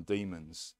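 usual speaking voice

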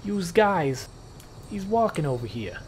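slow, high, hammed-up New Jersey tough guy; a put-on New Jersey street accent, drawn out; New Jersey accent, openly faked; mock New Jersey accent. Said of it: "I don't actually know a Jersey accent".

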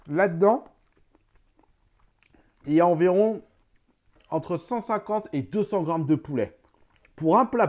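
speaking around food in mouth; muffled chewing between words; mouth full, chewing. Real, not acted. Seen 0: Bite taken at 0.2, jaw chewing while speaking through 6.3.

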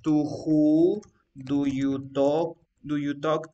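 "who" drawn out with a rise; slow dictation. Shown as a demonstration. Teacher recalling how she wrote the question, slow dictation. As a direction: spell the question out slowly, word by word. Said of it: "te acuerdas que yo ponía".

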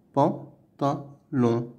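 "Pantalon" slowly as "pan", "ta", "lon"; syllable by syllable. Shown as a demonstration. Teacher counting out sounds for pupils, "Pantalon" in three slow pieces. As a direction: pause after each syllable of "Pantalon". Word modelled whole, then split.

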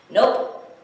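loud, abrupt nope; firm refusal. Acted. Elephant go-kart person answers alligator, firm loud refusal. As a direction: refuse with one punched word.

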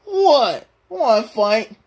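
high cartoon slacker picking a fight; Shaggy. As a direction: challenge in a squawky sidekick voice. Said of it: "Shaggy, I don't wanna fight you".

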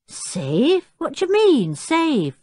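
taken aback, pitch leaping up; surprised. A narrator's reading. Jeremy is asked if his chimpanzee is safe, surprised. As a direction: query it in surprised disbelief.

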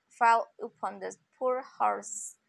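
ordinary delivery, nothing marked

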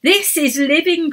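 excited cry. A narrator's reading. Emma leaping a crevice, thrilled cry. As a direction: call it out full of joy. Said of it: "cried Emma".

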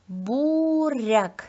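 "буряк" slow, with a long first syllable; teacher drawing out "буряк" by syllable; sound-by-sound demo. Shown as a demonstration. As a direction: stretch "бу", then "ряк" in "буряк". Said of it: "зробимо звуковий аналіз слів".

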